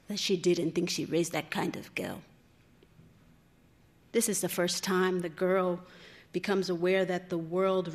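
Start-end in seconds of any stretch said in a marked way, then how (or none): 0.1-2.2 low, softened, saddened narration; sadly. A narrator's reading. Mother silencing her daughter about the boy, sadly. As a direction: let it sink low and quiet with disappointment. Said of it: "saying sadly".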